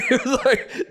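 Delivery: usual speaking voice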